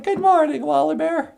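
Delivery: very high, sing-song good morning; [name]'s puppet voice. Acted. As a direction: pitch way up for the puppet. Puppet greets Wally Bear.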